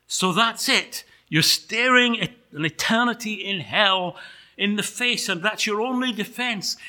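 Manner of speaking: high-pitched, insistent disbelief; incredulous angel. Acted. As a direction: press him, aghast at his only defense.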